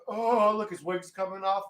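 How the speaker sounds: a hint of Trump gloating; lightly voiced Trump. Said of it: "Trump's like".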